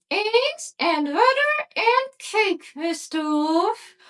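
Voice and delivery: put-on high, slow, sweet little girl. A narrator's reading. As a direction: answer innocently in a high little girl's voice. Girl answers the wolf.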